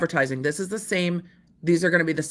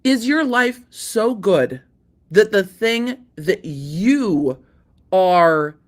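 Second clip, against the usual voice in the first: slowed right down, louder on so angry; slow incredulous jab; 4 dB louder, much slower than usual. Real, not acted. To the angry plaintiff, slow disbelieving jab. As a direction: drag it out in disbelief. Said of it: "I'm sidebarring myself".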